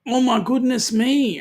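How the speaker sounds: gushing mock awe; acted amazement. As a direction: play up being bowled over. Voicing an awed cruiser's reaction.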